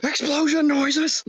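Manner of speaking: high, slow mock boom call; explosion noises. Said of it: "if you're making explosion noises".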